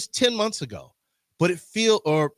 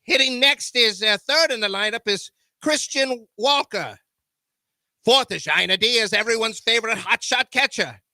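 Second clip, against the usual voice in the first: put-on old-time radio announcer, higher; 1930s announcer voice; 4 semitones higher than usual. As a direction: call the lineup like a 1930s broadcast. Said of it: "in my nineteen thirties voice".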